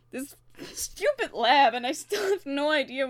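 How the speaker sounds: loud bitter frustration; angry. Acted. Frustration at lab.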